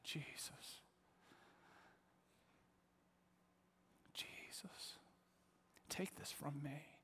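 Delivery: hushed, pleading whisper; whispered prayer. Retold or imitated. Praying alone, overwhelmed, a pleading whisper. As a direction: whisper it as a desperate plea.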